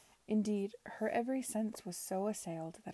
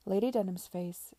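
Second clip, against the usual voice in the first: ordinary delivery, nothing marked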